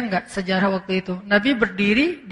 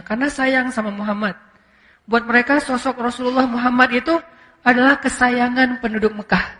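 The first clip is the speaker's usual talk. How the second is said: ordinary talk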